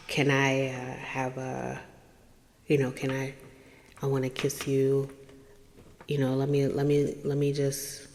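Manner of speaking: very soft, low hesitant come-on; imitated hushed, low coaxing; murmured sweet nothings. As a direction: murmur a halting request for a kiss. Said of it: "he's, you know, whispering sweet nothings".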